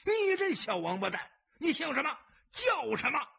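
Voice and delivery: high-pitched henchmen's yell; furious high yelling; loud angry shouting. A narrator's reading. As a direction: shout him down in outrage. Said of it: "纷纷大声喝道".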